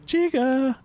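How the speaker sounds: high, loud mimicked door jingle; high-pitched mechanical mimicry; elevator chime imitation. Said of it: "the elevator door goes".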